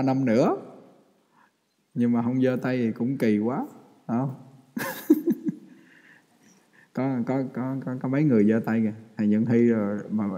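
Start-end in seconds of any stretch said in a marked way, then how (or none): 4.8-5.5 breathy laugh. Real, not acted.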